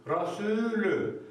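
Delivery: "Rasulü" drawn out slowly, light and clear; slow recitation. Shown as a demonstration. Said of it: "Buraya kadarki okunuşu budur".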